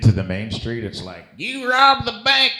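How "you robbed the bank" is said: hammed-up shootout actor. Comedian mimics a Cowtown actor's shootout line, hammed-up high and loud. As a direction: bellow it like a bored stage cowboy.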